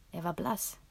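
ordinary delivery, nothing marked

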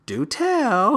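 high, drawn-out mock intrigue; intrigued Nev. Nev urging the mom on, high and hammed-up. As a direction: tease it out, high and curious.

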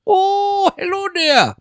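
put-on very high, loud old professor voice; hammed-up Woody Woodpecker professor; mock cartoon scientist greeting. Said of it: "Sounds like Irish Indian".

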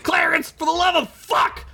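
high, exasperated scream. A narrator's reading. Ugla screams at the pirate, fed up. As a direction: scream it in frustration. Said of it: "Ugla screamed".

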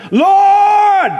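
high, loud, drawn-out mock cry; hammed-up shouted invocation; put-on praying voice. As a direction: belt it out like an over-the-top prayer. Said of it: "start to use a whole different voice".

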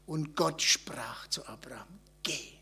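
low, slow, near-whispered scripture; hushed, measured Bible quote; hushed solemn command. Acted. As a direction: quote God's command softly and slowly. God's command quoted solemnly, then contrasted.

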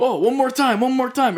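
high, eager mock voice; eager helper. The venue owner to Trevor, eager and high. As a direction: chirp it like an overeager helper.

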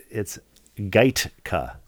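Gaitka slow, stress on GAIT. Shown as a demonstration. Host giving the pronunciation key, stressed. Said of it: "Emphasis on the first syllable".

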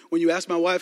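ordinary delivery

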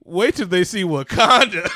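loud, hyped-up exclamation; building to a big shout; gleeful shout. Real, not acted. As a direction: belt it out triumphantly. Gleeful boast about favorite part.

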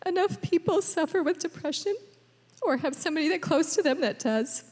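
real high, choked-up voice; high-pitched, holding back crying; tearful. Real, not acted. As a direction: push on through tears. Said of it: "go the whole morning without crying".

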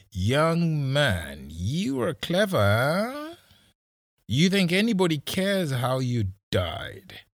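high, slow, swooping menace; taunting threat. A narrator's reading. Policeman with gun to boy's forehead, high drawn-out taunt. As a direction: toy with him, stretching the words.

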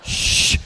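long, firm shush. Retold or imitated. Father silencing wife during dad moment, shushing. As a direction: hush someone with a drawn-out hiss. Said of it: "you can shush your wife".

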